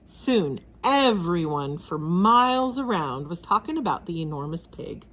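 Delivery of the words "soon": usual speaking voice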